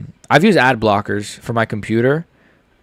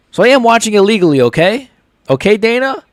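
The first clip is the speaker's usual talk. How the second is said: defensive insistence; 9 semitones higher, 4 dB louder than usual. Real, not acted.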